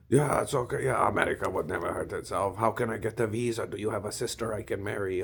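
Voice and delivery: put-on fawning stranger in a light Indian accent. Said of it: "I use that accent".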